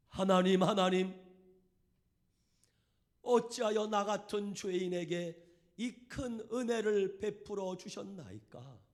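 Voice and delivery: loud, slow, pleading cry to God; wailing prayer. Retold or imitated. Leper man at the back crying out to God, wailing. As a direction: pour it out like a sobbing man at prayer. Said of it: "그러면서 통곡을 하고 우는 거예요".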